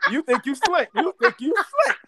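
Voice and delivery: high, loud, laughing accusation; amused call-out. Real, not acted. Guest catches the host fishing for his age, laughing call-out. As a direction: crow it loudly through a laugh.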